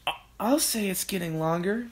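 high, thin character voice; Missus Cranky. A narrator's reading. Missus Cranky agreeing about the neck, high stammering voice. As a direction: stammer the start, then rise in disbelief. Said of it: "Missus Cranky said".

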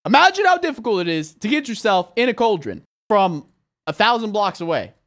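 ordinary talk, nothing marked

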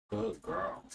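ordinary talk, nothing marked

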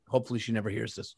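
fast, soft throwaway aside; quick quiet aside. Real, not acted. Host confiding to cohost about wife, quick quiet aside. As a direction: toss it off under your breath.

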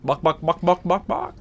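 soft clucking chicken calls. Retold or imitated. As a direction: cluck like a chicken to call them. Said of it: "I hear chickens".

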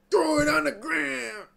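high, yelled like a song hook; mock belted yell. Belts the line back loudly.

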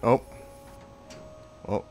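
normal talking voice

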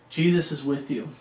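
usual speaking voice

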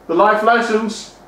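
deep, pompous voice lecturing on lessons; stuffy moralizing voice. Acted. Host questioning the guest, in a deep, pompous put-on voice. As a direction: talk down like a prim old schoolmaster.

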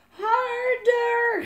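high, long urging scream. A narrator's reading. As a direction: shriek it as a stretched command. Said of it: "gilde Elise".

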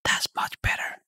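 a hushed whisper right into the mic. Real, not acted. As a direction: drop to a whisper, no voice. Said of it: "I hate when I whisper".

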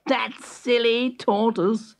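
slow, high, scoffing character voice; drawn-out, high-pitched disdain; scornful hare. A narrator's reading. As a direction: think aloud as the cocky hare. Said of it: "thought Hare".

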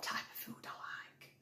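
soft whisper to the camera; whispered aside. Real, not acted. Telling viewers what food she likes, in a whisper.

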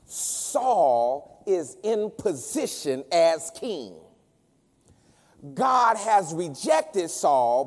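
slow, weighty pulpit proclamation with high peaks; full-force preaching. Real, not acted. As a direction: drive it home with stretched, ringing key words.

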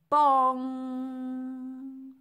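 loud, high bong with a long ringing hum; sung-out bell strike, held on the ng; ringing bell imitation. A narrator's reading. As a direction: strike it hard and let it ring.

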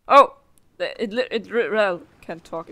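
ordinary talk

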